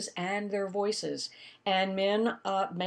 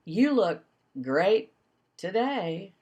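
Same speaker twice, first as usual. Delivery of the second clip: sarcastic; 3 dB louder, much slower than usual. Shown as a demonstration. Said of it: "the voice and the shift in pitch is what conveys irony".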